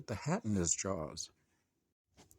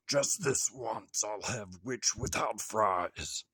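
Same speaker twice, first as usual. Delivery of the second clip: menacing dragon; slower than usual. A narrator's reading. Dragon threatens.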